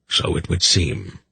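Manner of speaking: usual speaking voice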